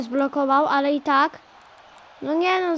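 ordinary delivery

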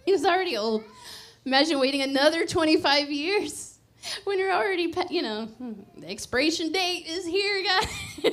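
giddy laughing sermon. Real, not acted. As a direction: laugh through the joke. Laughing at Abraham's age.

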